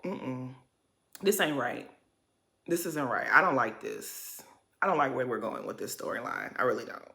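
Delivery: low, soft, troubled aside; quiet disapproval. Real, not acted. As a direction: sink into hushed, low disappointment. Disapproves of upsetting storyline.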